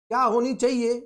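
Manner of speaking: ordinary delivery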